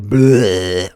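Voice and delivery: slow drawn-out zombie bleh; zombie groan. Retold or imitated. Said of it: "like a zombie impression".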